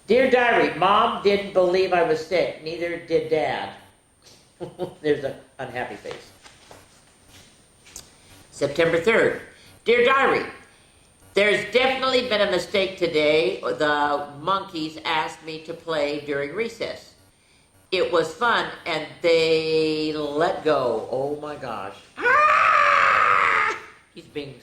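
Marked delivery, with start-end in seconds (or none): 0.1-3.7 lively storybook read-aloud, a bit higher; animated picture-book narration; reading to children. A narrator's reading. Reads diary entries aloud. 9.8-21.1 lively storybook read-aloud, a bit higher; animated picture-book narration; reading to children. A narrator's reading. Reads diary entries aloud.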